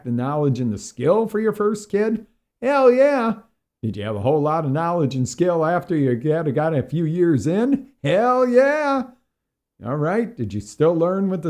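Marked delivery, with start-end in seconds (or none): 2.6-3.4 loud, high burst of agreement; emphatic, pitched-up holler; emphatic shout. Real, not acted. Emphatically answering his own question. 8.0-9.1 loud, high burst of agreement; emphatic, pitched-up holler; emphatic shout. Real, not acted. Emphatically answering his own question.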